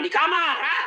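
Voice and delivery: the clerk answers her boss sharply to shut him down; firm. Retold or imitated. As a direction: snap it back as a curt challenge. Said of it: "Coba kalau kau tegas".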